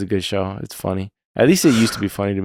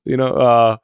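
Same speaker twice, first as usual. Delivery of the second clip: usual speaking voice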